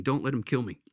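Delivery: ordinary delivery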